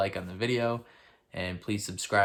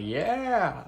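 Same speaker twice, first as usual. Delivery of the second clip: mock cheer; 9 semitones higher, 5 dB louder than usual. Voices queen's cheer.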